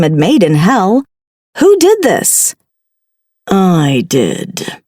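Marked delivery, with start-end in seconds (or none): 3.5-4.8 low, dark villain voice; low dark hiss. Acted. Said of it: "a low, dark voice hissed".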